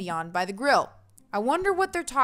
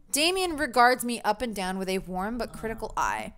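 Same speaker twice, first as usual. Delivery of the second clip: usual speaking voice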